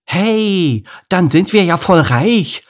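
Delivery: put-on high, loud kid exclamation; high-pitched boyish excitement; excited lion cub. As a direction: crow it like a delighted child. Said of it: "meinte Lukas".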